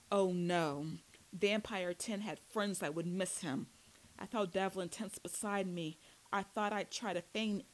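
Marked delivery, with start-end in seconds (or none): none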